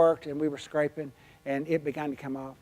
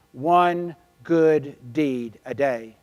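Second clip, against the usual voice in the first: each of "One good deed" slowly drawn out and punched; emphatic motto; 8 dB louder, much slower than usual. Real, not acted. Speaker recites her imprinted daily rule, slow and emphatic. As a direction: hammer "One good deed" word by word, louder.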